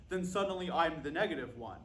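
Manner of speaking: ordinary talk, nothing marked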